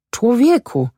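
a mild narrated shout; called out. A narrator's reading. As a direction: call out to the man in dismay. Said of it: "zawołał bakałasz".

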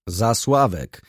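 restrained announcing cry; a narrator's contained shout; mild shout. A narrator's reading. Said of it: "krzyknął Ochocki".

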